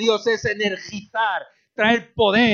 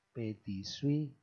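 ordinary talk

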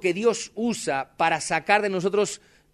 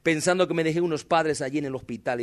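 ordinary talk

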